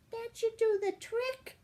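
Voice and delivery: high, pleased little fairy voice; a sly fairy pleased with her trick; smiling fairy. A narrator's reading. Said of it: "smiled the naughty fairy".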